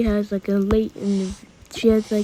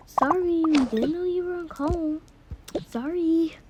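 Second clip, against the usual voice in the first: higher put-on child voice; apologetic little siblings; 6 semitones higher than usual. Siblings apologizing to the older one on a call, high pretend voice. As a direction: play a small scolded kid saying sorry.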